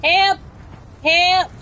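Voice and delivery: high, pleading cry of help; calling for help. Pretend. Downed streamer calls teammates for help, high pleading cries.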